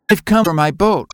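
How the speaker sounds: put-on high, firm child voice; a boy claiming what's his; determined little boy. A narrator's reading.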